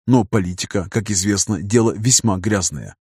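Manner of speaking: ordinary delivery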